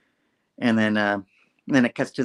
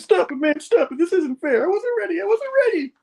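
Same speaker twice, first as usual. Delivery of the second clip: much higher whiny protest; a sulky villain whining he wasn't ready; 4 dB louder than usual. Retold or imitated. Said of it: "He's like".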